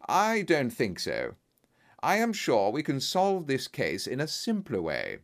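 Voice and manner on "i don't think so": plain reading voice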